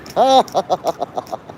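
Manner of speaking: staccato, put-on villain laugh; Joker-style cackle. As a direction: cackle like a mock supervillain. Plays Joker daring people to call.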